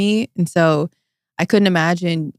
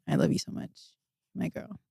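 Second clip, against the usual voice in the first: choked up; 13 dB quieter than usual. Real, not acted. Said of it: "You want me to cry right now?".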